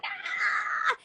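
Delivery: high, unvoiced mock scream; imitated high shriek of the girl being hit; mock cry. Storyteller imitating the girl she punched, mock scream.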